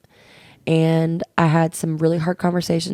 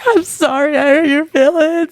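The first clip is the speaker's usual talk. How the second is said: higher, louder whimpering apology; hammed-up tearful sorry, pitched up; mock crying apology; 10 semitones higher, 4 dB louder than usual. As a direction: imitate a friend sobbing out sorry. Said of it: "And then she'd start crying".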